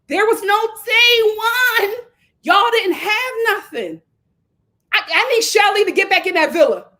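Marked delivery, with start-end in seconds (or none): 0.1-4.0 loud, high exasperated shout; outraged rebuttal. Real, not acted. Viewer shouting back at the contestant's plea, outraged rebuttal. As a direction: shout down the delusion.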